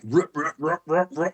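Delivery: sputtering engine snore imitation. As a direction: chug it out like a Harley that won't start. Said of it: "sound like a Harley trying to start".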